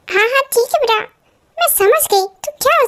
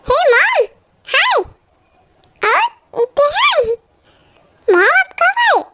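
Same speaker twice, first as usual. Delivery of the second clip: put-on higher childlike voice, cheerful greeting; a kid brightening as mom walks in; excited little boy; 11 semitones higher, slower than usual. Little son greeting his mother home, high and happy.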